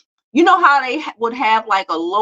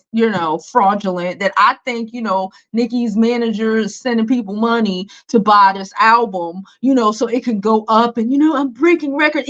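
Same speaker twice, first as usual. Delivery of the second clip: ordinary talk, nothing marked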